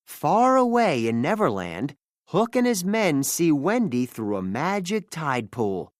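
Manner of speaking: ordinary delivery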